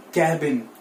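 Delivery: "cabin" as KAB-in, short open A; correct pronunciation. Shown as a demonstration. Teacher leading learners to repeat, cabin as KAB-in. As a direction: model cabin with a falling tune. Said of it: "the right pronunciation for this word is".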